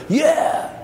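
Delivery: high, drawn-out mock cry of recognition; excited recognition. Greeting an old church acquaintance in a retold reunion, excited. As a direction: let the yeah leap up high and ring. Acted reply, delighted recognition in story.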